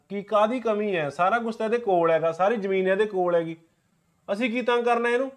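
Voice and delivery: loud, high-pitched self-defence; indignant protest. Acted. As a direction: argue back loudly, voice pushed up in indignation. Brother defends himself in family quarrel. (ਗੁੱਸੇ ਵਿੱਚ ਉੱਚੀ ਆਵਾਜ਼ ਨਾਲ ਸਫ਼ਾਈ ਦੇਣਾ)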